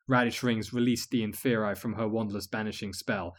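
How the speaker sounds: ordinary delivery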